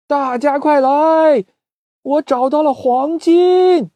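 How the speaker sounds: high, loud, slow announcing call. Acted. Chick calling the whole town to see gold, high and loud. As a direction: shout out the gold to everyone. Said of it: "大声地喊".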